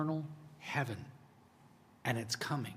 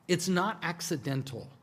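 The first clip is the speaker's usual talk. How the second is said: ordinary talk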